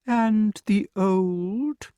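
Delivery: high, very slow, drawn-out sneer; sarcastic. A narrator's reading. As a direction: drag it out sarcastically. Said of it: "inquired Archimedes sarcastically".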